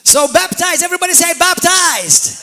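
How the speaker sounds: loud, high preacher's cry; rallying shout. Real, not acted. Preacher telling everybody to say baptized, rallying shout. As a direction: shout it out for the whole congregation to repeat. Rallies congregation to repeat a word.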